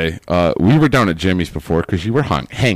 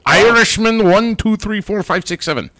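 bad Irish accent; 10 semitones higher than usual. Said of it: "That is not an Irish accent".

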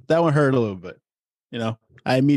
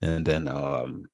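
ordinary delivery

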